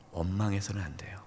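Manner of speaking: whispered, grave plea; hushed warning. Real, not acted. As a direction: drop to a whisper to warn them. Solemn warning to congregation. (속삭이듯 간절한 경고)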